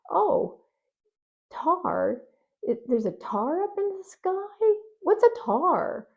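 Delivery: high, slow puzzled "tar?"; mock confusion. Parent feigning puzzlement at a child's "tar". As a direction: exaggerate your confusion. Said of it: "you can just say".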